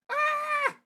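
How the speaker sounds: shrill cry of attack; high scream. Acted. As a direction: scream as you're hit.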